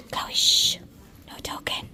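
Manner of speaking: barely audible library reminder; whispered shushing. Real, not acted. Mum hushing her child in the library, whispered.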